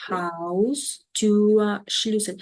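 "Haustürschlüssel" stretched out slowly part by part; slow, careful teacher demo; slow model word. Shown as a demonstration. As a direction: draw out "Haustürschlüssel" so learners hear each piece. Teacher models long compound slowly.

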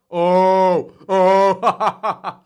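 loud, high drawn-out "oh" breaking into laughter; gleeful jeering. Real, not acted. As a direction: hoot in mock sympathy, then laugh. Jeering at Rogan crying on air.

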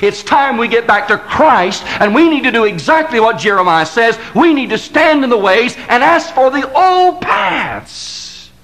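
closing altar-call crescendo; building preaching. Acted. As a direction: drive the refrain up to a crescendo.

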